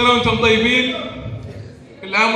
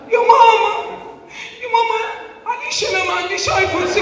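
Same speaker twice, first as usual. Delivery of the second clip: whiny daughter; 8 semitones higher, faster than usual. Retold or imitated. Girls whining to their mother.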